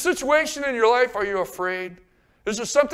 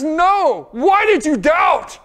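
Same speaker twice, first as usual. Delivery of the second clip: firm rebuke; 7 semitones higher, 10 dB louder, much slower than usual. Acted. Jesus rebuking Peter.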